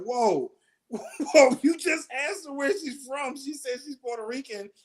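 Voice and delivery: replaying the shock with a laugh in it; incredulous whoa. Reacting to the comic calling her Mexican, incredulous. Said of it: "And I was like".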